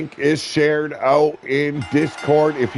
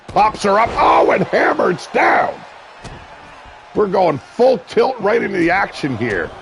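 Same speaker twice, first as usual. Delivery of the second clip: higher and louder, calling the slam; excited shout; 7 semitones higher, 3 dB louder than usual. Real, not acted. Commentator calling a powerbomb, excited shout. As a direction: ride the big move up. Big move lands in match.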